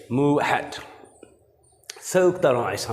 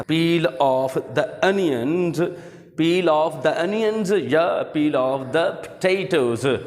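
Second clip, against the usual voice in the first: slow teaching read; 4 semitones higher, much slower than usual. Shown as a demonstration. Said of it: "हमने एक sentence किया था".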